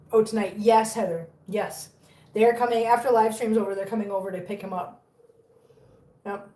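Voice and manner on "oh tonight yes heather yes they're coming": ordinary talk, nothing marked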